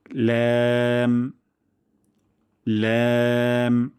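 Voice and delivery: long, even ল্যাম with fronted ae; thin lam. Shown as a demonstration. Teacher modelling for practice, long thin ল্যাম. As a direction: hold ল্যাম light from the tongue tip. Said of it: "পাতলা সাউন্ডে".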